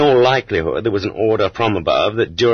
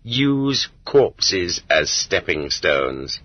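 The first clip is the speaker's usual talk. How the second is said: very slow, deliberate quote; grim motto; 2 semitones lower, much slower than usual. Retold or imitated. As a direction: spell the rule out slowly, with cold irony. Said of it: "the ethics of our security officers are".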